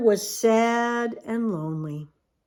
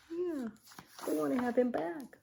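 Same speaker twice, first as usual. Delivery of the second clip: soft aside; 4 semitones higher, 11 dB quieter than usual. Real, not acted. Quiet aside to the child.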